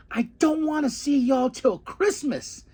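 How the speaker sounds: high-pitched mock-angry outburst; acted mad. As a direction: imitate a furious wrestler, voice way up. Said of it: "he is so mad".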